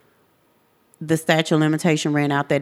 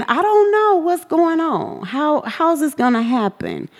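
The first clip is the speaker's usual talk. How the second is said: high, overwhelmed questioning; high-pitched bewildered fretting; retold worry; 10 semitones higher than usual. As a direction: act out anxious thoughts in a raised voice. Retold panicked thoughts.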